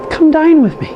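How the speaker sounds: 1 cheery sign-off. Real, not acted. Sign-off to viewers.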